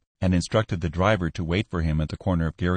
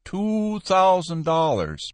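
Joost's flat price; 9 semitones higher, slower than usual. Acted.

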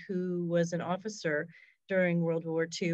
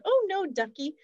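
put-on high, sweet German accent on British English; imitating a German lady speaking British English, pitched up; lightly imitated German accent, reassuring; German lady's accent; 11 semitones higher than usual. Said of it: "German accent, speaking British English".

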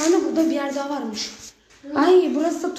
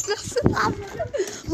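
mock fright; 6 semitones higher, 5 dB quieter, much slower than usual.

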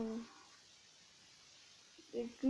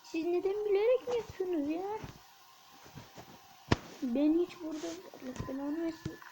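exasperated whine; 3 semitones higher than usual. Real, not acted. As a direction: whine at the unfair game. Exasperated at the game.

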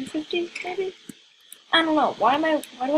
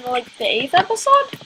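normal talking voice

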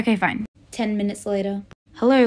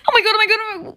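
high, gasping oh my god; squealing with teen delight; excited gasp; 11 semitones higher, 4 dB louder, much faster than usual. Acted. Teen learns of concert tickets.

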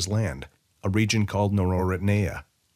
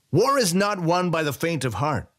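higher, lordly proclamation; proclaiming general; 9 semitones higher than usual. A narrator's reading. General lecturing Weyra, higher proclaiming voice. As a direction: announce it like a warlord's maxim. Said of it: "the general proclaimed".

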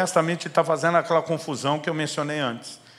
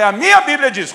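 much higher and louder, mocking stress on minha; sarcastic retort; 12 semitones higher, 13 dB louder than usual. Pastor retorting to a critical visitor, mock sarcastic. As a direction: throw minha back at him with biting sarcasm. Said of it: "ele tá sendo sarcástico".

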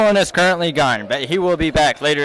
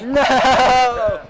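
shouted no; 8 semitones higher than usual. Real, not acted. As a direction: yell it to stop him. Protesting Nick eating the potato.

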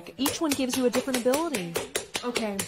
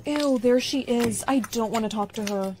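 ordinary talk

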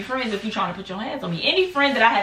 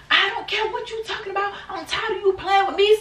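high put-on voice, defiant; mocking Crystal; 10 semitones higher than usual. Friend retold refusing to back down, high mock voice. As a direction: sass it up as the angry friend.

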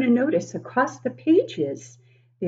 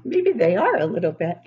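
ordinary delivery

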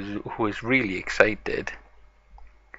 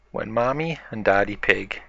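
usual speaking voice

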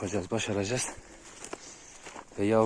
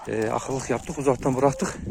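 ordinary talk, nothing marked

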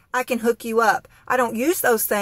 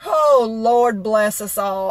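slow, drawn-out, put-on weariness; dramatic lament; 3 dB louder, slower than usual. Begging heaven after the grocery chaos, slow mock lament. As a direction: sigh it out like a hammed-up prayer.

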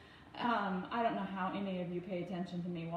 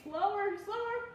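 high-pitched urgent caution; 10 semitones higher than usual. Real, not acted. Parent cautioning child while pouring water, urgent high caution. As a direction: call it out higher as a quick check.

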